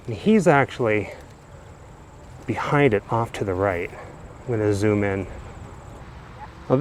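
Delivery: ordinary talk, nothing marked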